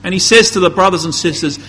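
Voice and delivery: ordinary talk, nothing marked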